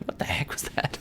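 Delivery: startled whisper. Acted. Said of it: "they're like".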